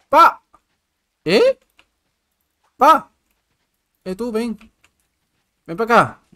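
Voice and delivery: high loud shouts after a bug; yelling across the room. Real, not acted. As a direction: yell at it to come here. Chasing a bug, calling it.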